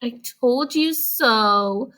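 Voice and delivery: slow, smug little-sister voice; little sister gloating at Splat; told-you-so. Acted. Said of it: "said his little sister".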